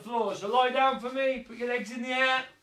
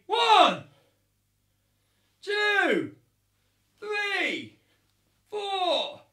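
high, loud shouted numbers; really loud count; 5 semitones higher, 5 dB louder, much slower than usual. Shown as a demonstration. Coach shouting the count to ninjas, really loud. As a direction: call every count so the far stand hears. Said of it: "Really loud, so I can hear you".

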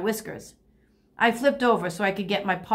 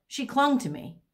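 usual speaking voice

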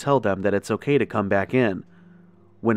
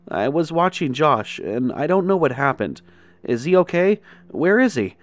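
anxious questions; 7 semitones higher, 4 dB louder than usual. A narrator's reading. Frightened boy asking about brother.

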